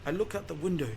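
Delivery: ordinary delivery, nothing marked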